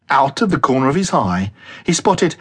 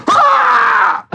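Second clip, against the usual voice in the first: startled yelp; 5 dB louder than usual. Acted. Crying out mid backflip.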